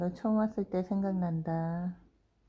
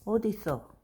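normal talking voice

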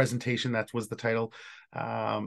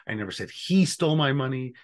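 "he" picked out from the rest; stress on he. Shown as a demonstration. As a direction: lean on "he" to single that person out. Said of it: "depending on which word you emphasize".

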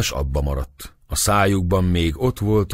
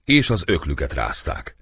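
usual speaking voice

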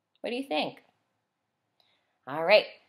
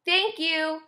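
leaf voice; 7 semitones higher than usual. A narrator's reading. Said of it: "said the leaf".

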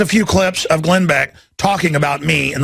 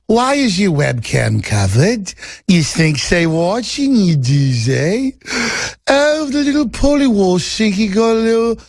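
Oliver Twist Cockney. Retold or imitated. Interviewer teasing his guest about little green men, in mock Cockney. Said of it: "He didn't really speak with that Oliver Twist Cockney accent".

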